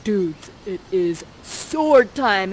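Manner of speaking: slow, swaggering dude voice; Ferdinand. Acted. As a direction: draw it out like a hero spoiling for a fight. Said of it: "Ferdinand, you're like this to Prospera".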